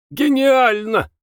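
a high, delighted cry; shout. A narrator's reading. Lyutov cries out in delight at the deacon's verse, high shout. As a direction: fling the word out in rapture. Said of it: "крикнул Лютов".

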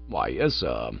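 low, smooth valet voice; Jeeves the butler. A narrator's reading. Butler Jeeves informing Bertie of a note, low and deferential.

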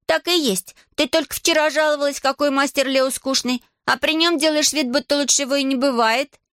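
put-on high-pitched girl's voice, telling on someone; accusing girl. A narrator's reading. Girl accuses her relative before everyone.

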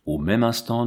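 plain reading voice

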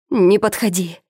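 cracking frightened cry; voice breaking. A narrator's reading. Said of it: "срывающимся голосом вскрикнула Эльжбета".

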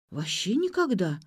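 plain reading voice

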